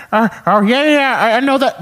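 high, loud cartoon dad bluff; Peter Griffin. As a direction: stammer along as a goofy cartoon dad. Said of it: "finally I broke character".

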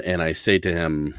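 ordinary talk

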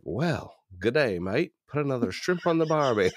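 mock Australian accent, high and swooping; Jim Carrey. Limo driver answers an Austrian lady in mistaken Australian accent. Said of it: "that's a lovely accent".

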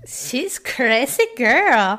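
slowly stretched laughing remark; slow, drawn-out. Real, not acted. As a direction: drag the words out.

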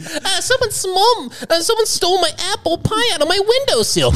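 very high put-on falsetto; high-pitched townsperson. Townsperson reports a stolen pie in sitcom spoof, high falsetto.

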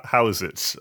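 ordinary talk

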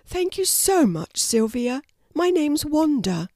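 high-pitched storybook mum; high, appreciative animal character; grateful mother wallaby. A narrator's reading. Mother wallaby thanks Sylvia.

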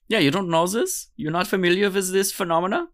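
high, incredulous mock German accent. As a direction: question them in comic German English. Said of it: "it just comes out as a German accent speaking English".

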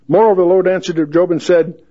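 lifted King James reading voice; scripture reading. Acted. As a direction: read it out like the pulpit.

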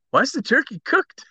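high, baffled question; puzzled question. Retold or imitated. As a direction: ask it baffled. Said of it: "Zids woke up ... and goes".

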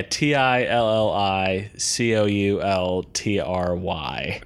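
letter by letter, spelled out; spelling it out. Shown as a demonstration. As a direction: spell each letter clearly: T I L L I C O U L T R Y. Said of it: "I can actually spell it".

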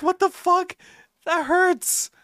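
a dad hamming up hurt feelings; mock hurt shock. Dad hurt by son's insult.

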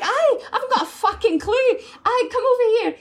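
high, loud Irish voice; excited Irish accent. Retold or imitated. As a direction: belt it in an Irish lilt. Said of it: "Can I make her Irish?".

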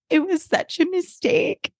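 high, drawn-out mock lament; acted regret. [name] admitting her mistake to Eric, high regretful wail. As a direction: plead it slowly in a high voice.

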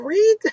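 very high, loud squeak; comic questioning squeal. Acted. Repeats comic squeal, cracks up.

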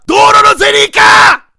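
an all-out proclamation of 道路のゼリー化！; full shout. Retold or imitated. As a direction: yell 道路のゼリー化！ with all your force. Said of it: "案を発表しだした".

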